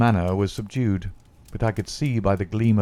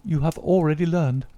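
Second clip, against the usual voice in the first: lady's voice pitched higher, worried; a fretful wife's raised voice; anxious lady; 8 semitones higher than usual. Acted. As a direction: confess it all as the frightened wife. Lady Hilda confessing to Holmes.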